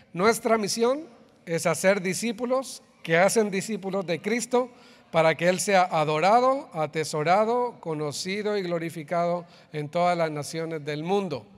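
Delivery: loud, unison-style group recitation; reading aloud together. Acted. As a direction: read it out together like a creed. Congregation reads mission statement aloud together.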